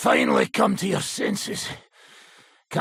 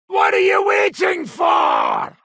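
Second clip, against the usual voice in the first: loud, slow, higher demand; impatient shout; 8 semitones higher, 6 dB louder, slower than usual. Acted. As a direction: bark at him to hurry.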